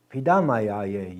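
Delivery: Pidamayaye said slowly, every syllable clear; slow Dakota demo. Shown as a demonstration. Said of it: "You made me thankful".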